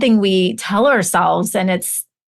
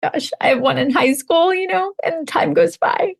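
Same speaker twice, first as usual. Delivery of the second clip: fighting back tears mid-sentence; choking up; 8 semitones higher than usual. Real, not acted. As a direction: voice tight with tears, rising high. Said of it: "now I'm choking up".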